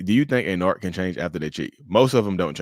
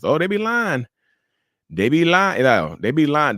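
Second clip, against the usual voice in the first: emphatic; 6 semitones higher, 5 dB louder than usual. Real, not acted. Emphatic repeated exclamation.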